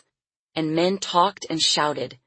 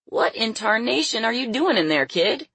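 higher, incredulous folksy question; an old hand taken aback, pitch raised; astonished old man; 6 semitones higher than usual. A narrator's reading. Startled old circus man questions child.

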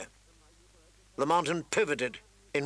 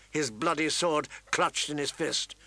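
ordinary delivery

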